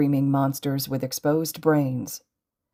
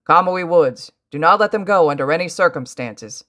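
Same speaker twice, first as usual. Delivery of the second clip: loud, commanding shout; ordering; 3 semitones higher, 7 dB louder than usual. A narrator's reading. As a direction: give a firm, urgent order. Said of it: "All Might ordered".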